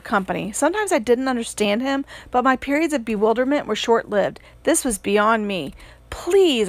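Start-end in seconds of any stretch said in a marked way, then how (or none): 6.1-6.7 higher, begging please; a touch of a desperate plea; pleading. A narrator's reading. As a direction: beg him to stay. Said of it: "I pleaded".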